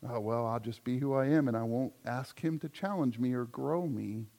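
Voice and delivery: mock complacent shrug. Mocking complacent believer's excuse.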